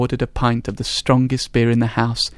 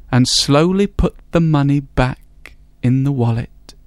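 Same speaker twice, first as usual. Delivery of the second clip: ordinary delivery, nothing marked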